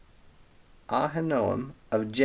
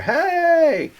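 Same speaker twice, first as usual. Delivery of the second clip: long, high, loud holler; 6 dB louder than usual. Retold or imitated. David on the mountaintop calling down to the troops, hollering. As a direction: call out across the valley. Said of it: "he starts hollering at the troops".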